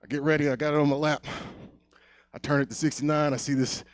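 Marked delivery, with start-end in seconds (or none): none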